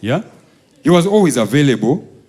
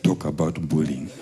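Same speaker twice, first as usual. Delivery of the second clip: low menacing mutter; 9 semitones lower, 10 dB quieter than usual. Retold or imitated. Dad leans forward and quietly threatens the rich guy, low menacing voice.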